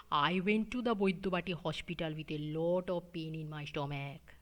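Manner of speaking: English pulled into Bengali sounds; Bengali accent. Shown as a demonstration. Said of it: "this is called mother tongue pull".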